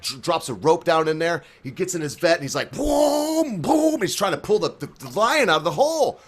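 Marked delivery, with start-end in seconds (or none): 2.7-4.0 high, drawn-out car noise; cartoonish high-pitched revving; imitated engine boom.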